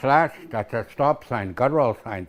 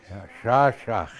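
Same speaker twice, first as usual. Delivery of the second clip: normal talking voice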